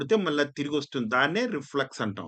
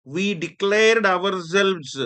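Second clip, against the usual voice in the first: slow, deliberate classroom reading of the sentence; slow example reading; 4 semitones higher, 5 dB louder, much slower than usual. Shown as a demonstration. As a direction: read it out slowly for students. (నెమ్మదిగా ఉదాహరణ చదవడం)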